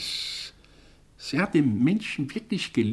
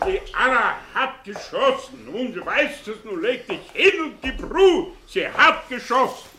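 hastiges Beschwichtigen; 9 semitones higher than usual. Acted. Beruhigt den Fiebernden hastig.

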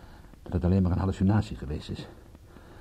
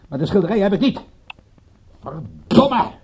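loud, high-pitched protest ending in a curse; angry, raised-voice outburst; exasperated outburst; 11 semitones higher, 6 dB louder than usual. Acted. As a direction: burst out in frustrated denial, then swear. Kidnapper call, denial and curse.

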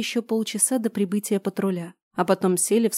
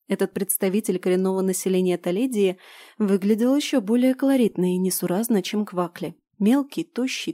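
plain reading voice

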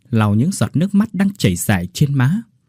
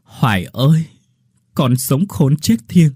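anguished plea; 5 semitones higher, 3 dB louder than usual. Acted. Father calls dead daughter for vengeance.

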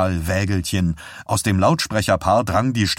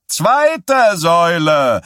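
higher, louder, slow shrill cry; shrill shout; 8 semitones higher, 6 dB louder, slower than usual. A narrator's reading. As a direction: shriek it into the microphone. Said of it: "schrillte Stilson ins Mikrofon".

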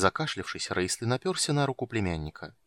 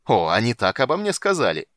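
mocking outburst; 8 dB louder than usual. A narrator's reading. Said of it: "разразился ужасающим смехом".

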